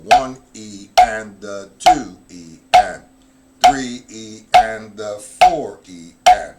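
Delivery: loud, slow, even counting aloud. Shown as a demonstration. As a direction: count each beat out like a drum teacher. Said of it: "nice and slow".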